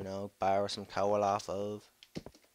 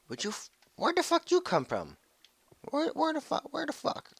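very high panicked cry; panicked high cry at a creeper; shocked shriek; 4 dB louder than usual. Real, not acted. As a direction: squeal it in alarm. Surprised by creeper.

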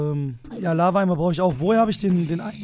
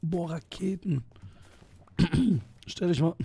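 hoarse voice; 2 semitones higher, slower than usual. Real, not acted. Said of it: "ich hör mich an wie ein Hurensohn".

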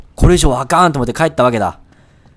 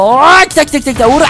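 alarmed "here they come"; 9 semitones higher, 6 dB louder than usual. Real, not acted. Enemies coming again.

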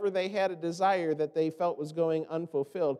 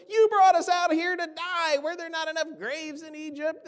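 higher, louder whining accusation; angry complaint; 9 semitones higher, 3 dB louder than usual. Retold or imitated. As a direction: throw it at Moses like a grievance. Israelites complain to Moses angrily.

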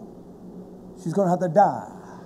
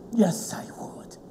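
slow breathy whisper; 3 semitones higher, 7 dB quieter, slower than usual. Real, not acted. Preacher quietly affirming his faithfulness, whispered. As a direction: drop to a bare whisper. Hushed aside after quiet vow.

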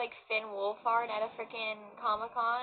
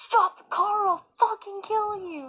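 higher, louder mock yell; quoting Miles; 8 semitones higher, 7 dB louder than usual. Fan reenacting the car scene for viewers, mock yell. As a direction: shout the threat again, half laughing. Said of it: "he was like".

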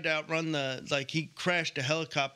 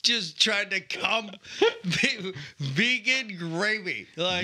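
laughing through words; 5 semitones higher, 4 dB louder, slower than usual. Real, not acted. Mocking friend's gravy quest.

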